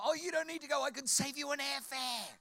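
high-pitched mock-helpful call; hammed-up, loud and high; joking. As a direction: put on a silly high voice for the joke. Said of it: "I thought I'd be funny".

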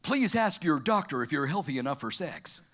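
low-pitched drug ad disclaimer; deep, measured mock voice-over; TV ad announcer. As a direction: read it like the end of a pharmaceutical ad. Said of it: "it finishes like this. It says".

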